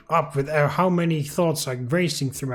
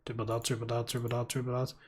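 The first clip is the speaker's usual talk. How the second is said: much softer, lower reading of the dots; hushed ellipsis; 6 semitones lower, 14 dB quieter, faster than usual. Shown as a demonstration. Reading a silent phone call's ellipsis, very hushed and low. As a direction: mutter the dots under your breath.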